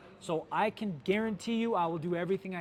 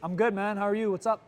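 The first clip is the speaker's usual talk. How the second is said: higher and louder, casual and chummy on the phone; friendly customer; 5 semitones higher, 6 dB louder than usual. Pretend. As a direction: play a relaxed customer who is glad you called. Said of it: "I'll be your customer".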